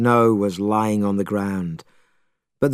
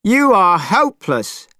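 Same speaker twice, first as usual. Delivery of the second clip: high, scornful yell; Monkey losing patience; angry shout; 8 semitones higher, 3 dB louder than usual. Acted. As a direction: snap it at him in disgust. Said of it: "shouted Monkey, angrier than ever".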